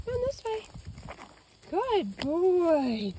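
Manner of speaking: coaxing the dog. Real, not acted. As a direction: lure the dog with a high voice. Calling and praising the dog.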